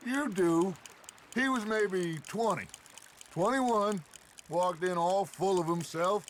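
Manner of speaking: loud, high-pitched gleeful retelling; gleeful teasing. Acted. Teasing a friend with an old story.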